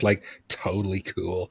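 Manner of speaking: soft, laid-back mock California voice; mock Los Angeles talk. Shown as a demonstration. Texan mimicking how Los Angeles people talk, lightly voiced. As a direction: toss it off like a lightly voiced LA dude. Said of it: "suddenly talk like this".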